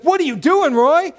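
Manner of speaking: loud, high-pitched coach's challenge; exasperated coach. Retold or imitated. Coach finds his player still on the bench, exasperated. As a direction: imitate a coach demanding an explanation. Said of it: "And, and he's like".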